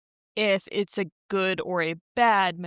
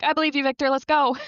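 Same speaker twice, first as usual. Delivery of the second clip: mock-eager cheer, higher and louder; eager belief; 6 semitones higher, 4 dB louder, faster than usual. Co-host retelling how she backed Victor, mock-eager. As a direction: playfully hype yourself up to follow him. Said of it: "I was like".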